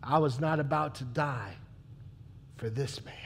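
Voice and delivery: breathy whispered aside. Acted. Pilate muttering his resolve to himself, whispered. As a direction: hush it to yourself.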